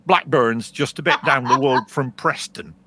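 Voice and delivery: loud Lancashire Blackburn accent; northern English accent. Shown as a demonstration. Musician obliging a listener's plea, northern English accent. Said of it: "please do a northern accent".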